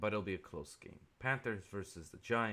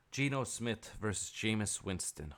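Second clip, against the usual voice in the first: ordinary talk, nothing marked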